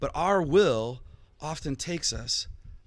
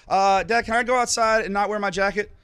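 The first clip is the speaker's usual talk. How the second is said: put-on higher, louder eager child voice; pleading kid; 5 semitones higher, 5 dB louder than usual. Pastor imitating his child asking dad, high and eager. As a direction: beg like a kid at breakfast.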